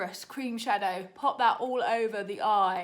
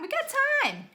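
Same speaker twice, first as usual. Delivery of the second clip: frantic; 6 dB louder than usual. Real, not acted. Racing the makeup timer.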